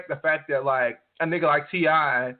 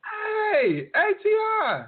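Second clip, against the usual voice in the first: starstruck fan shout; much slower than usual. Retold or imitated. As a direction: shriek the name like a starstruck fan spotting him. Fan calling out to celebrity.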